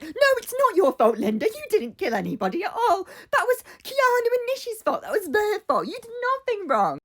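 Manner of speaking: very high, frantic shrieking imitation; mock screaming. Imitating Suki overtalking Linda, screaming for the whole square. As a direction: screech over someone to drown them out. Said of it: "She's screaming for the whole square".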